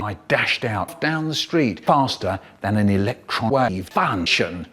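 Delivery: ordinary delivery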